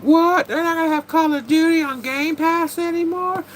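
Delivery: high, loud whiny mock outrage; mocking complainers. Streamer mocking complaining gamers in a whiny voice. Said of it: "people are complaining, like".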